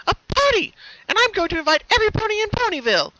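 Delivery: very high, loud party cheer; high fast cheering. Acted. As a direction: announce the party gleefully. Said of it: "She cheered".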